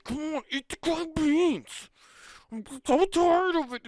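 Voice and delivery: put-on very high, slow, whining child voice; whiny kid. Kid at Christmas dinner begging the uncle, high whine. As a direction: whine like a starving kid waiting for presents.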